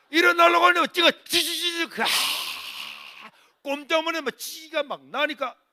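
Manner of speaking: loud, high preaching at full force; fervent preaching. Acted. Preacher telling the church how his mother was stuck in the pew, loud and fervent. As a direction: drive the story home to the congregation.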